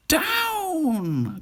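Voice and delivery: long, wailing, very high cry; the witch calls the broom down after losing her wand; witch cries down. Acted. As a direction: stretch the word out into a cry. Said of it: "cried the witch".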